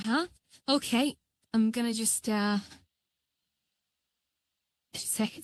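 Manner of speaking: ordinary delivery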